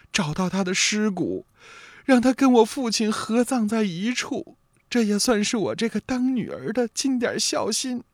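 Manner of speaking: put-on choked-up young wife. A narrator's reading. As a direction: confess tearfully in a young woman's voice, understated. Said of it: "说罢是泣不成声".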